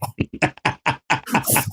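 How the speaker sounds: breathy, voiceless fit of laughter; real laugh. Real, not acted. Friend cracking up at giant octopus absurdity, real laugh. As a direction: crack up at your own story. Laughing at his own retelling.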